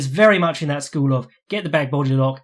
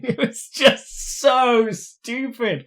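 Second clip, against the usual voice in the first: laughing disbelief; 9 semitones higher, much slower than usual. Real, not acted. Laughing at the event.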